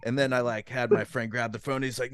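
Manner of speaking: ordinary talk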